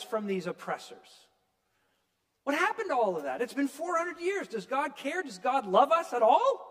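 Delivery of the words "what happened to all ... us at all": anguished pleading. Pretend. Voicing the people's doubt.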